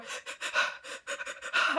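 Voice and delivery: imitated panicky breaths; nervous heavy breathing. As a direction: huff out shaky, overwhelmed breaths. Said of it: "breathing heavily and nervously".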